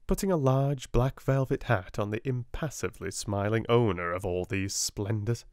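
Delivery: ordinary delivery, nothing marked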